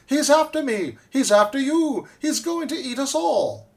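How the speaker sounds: high, loud panicked dentist; acted frantic high-pitched yelling; shrieking. A narrator's reading. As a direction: shriek the alarm in terror. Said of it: "the dentist shrieked".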